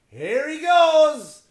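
high, stretched-out cheer; mock hype call. Comic cheering a fat kid on, drawn-out high call. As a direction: call it out like a ringside announcer.

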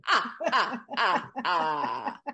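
counting high and loud, with a drawn-out cackle; hammed-up villain's count and laugh. Announcing that the Count is back, gleefully hammed-up. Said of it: "the Count is also back".

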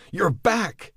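high, bright cry of relief; overjoyed shout of recognition; joyful cry. A narrator's reading. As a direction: cry out as the lost dog returns. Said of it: "he cried".

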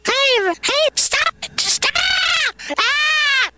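very high pitch-shifted yelling; high voice filter. Real, not acted. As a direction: squeak it through the effect. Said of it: "do Duncan Trussell".

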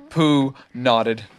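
ordinary delivery

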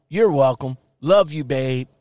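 normal talking voice

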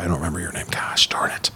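unvoiced muttered apology; whispering. Real, not acted. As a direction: whisper it to yourself, embarrassed.

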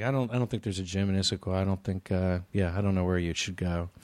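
a lightly voiced local shrugging; low local voice. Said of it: "Everyone's like".

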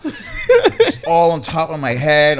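slow words through real laughter; laughing, still amused by the memory. Real, not acted. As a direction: laugh while recalling the cold water.

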